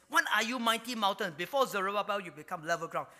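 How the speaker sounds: God mocking, voiced at full volume. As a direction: taunt a rival, loud and scornful. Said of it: "he was actually mocking the mountain".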